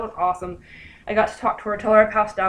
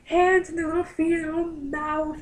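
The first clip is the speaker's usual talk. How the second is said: higher, sing-song gushing moms; gushing mothers; 9 semitones higher than usual. Retold or imitated. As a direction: coo over the baby like doting mothers. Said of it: "the mothers were like".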